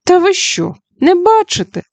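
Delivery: high, incredulous cry; can't believe she doesn't see; astonished exclaim. Acted. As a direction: brother gasps at the witch's blindness. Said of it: "вигукнув майстер Тік".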